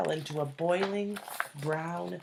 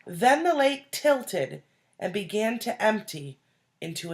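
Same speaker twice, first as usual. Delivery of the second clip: louder, building read-aloud suspense; dramatic story narration; 7 dB louder than usual. A narrator's reading. Teacher reading the scary scoop scene to children, building tension. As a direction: narrate the danger with rising tension.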